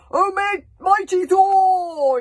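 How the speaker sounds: slow, high, loud bellow to Thor; yelled invocation. Acted. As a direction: cry out to the heavens. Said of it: "yelled the old lunatic".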